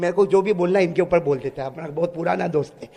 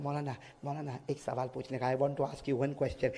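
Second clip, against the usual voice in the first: timid request; 6 semitones lower, 14 dB quieter than usual. Retold or imitated. Young man's timid question retold.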